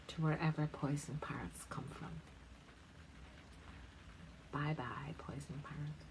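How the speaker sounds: soft whispered farewell. Real, not acted. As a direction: breathe it out like waving goodbye. Softly waving the parrot off.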